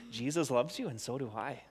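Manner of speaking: very soft and hushed; whispering. Shown as a demonstration. Leader joins the boys to say it to each other, whispering. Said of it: "doing a really good job of whispering".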